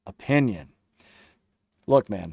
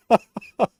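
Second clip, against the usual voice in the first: high laugh; 10 semitones higher, 6 dB louder than usual. Real, not acted. Laughing at chat comment.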